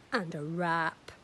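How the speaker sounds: ordinary delivery, nothing marked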